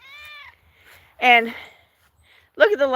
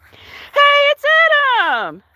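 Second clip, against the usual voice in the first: excited greeting; 13 semitones higher, 4 dB louder, slower than usual. Real, not acted. Spotting a viewer arrive.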